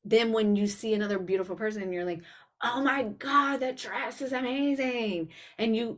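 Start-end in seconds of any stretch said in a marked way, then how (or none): 2.6-5.3 high, slow gushing admiration; excited compliment. Retold or imitated. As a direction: gush over a friend's dress. Voices admiring another person.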